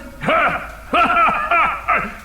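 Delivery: big mocking dragon laugh; booming dragon laugh. Dragon laughing at the little intruder, booming mockery. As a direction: laugh down at the tiny thief. Dragon scoffs at the small thief.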